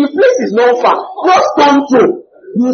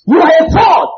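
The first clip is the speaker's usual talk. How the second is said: loud, high cry of fraud; accusing shout; 7 semitones higher, 4 dB louder than usual. Retold or imitated. A critic shouting at the preacher, loud accusing shout. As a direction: hurl the accusation at him.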